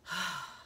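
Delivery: soft sigh of relief; relieved sigh. Shown as a demonstration. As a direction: let the air out, relieved. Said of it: "and then being relieved".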